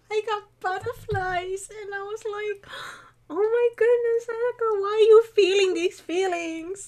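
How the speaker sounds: high, fluttery squeal of feeling; giddy gushing. Acted. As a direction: gush it high, overwhelmed by butterflies. Reenacts getting butterflies over scene.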